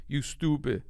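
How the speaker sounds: mock put-down with a Turkish accent. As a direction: imitate dad's Turkish-accented dismissal. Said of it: "In his Tur-, deep Turkish accent".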